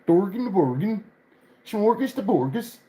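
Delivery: high, lilting mock-Swedish gibberish; Swedish Chef. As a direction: do a silly high Swedish Muppet babble. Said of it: "so you don't sound like the".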